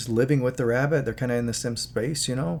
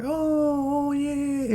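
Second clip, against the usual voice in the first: put-on much higher cooing baby voice; slower than usual. Baby playing with the rabbit in its crib, high delighted cooing. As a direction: coo like a delighted baby with a bunny. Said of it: "It's like".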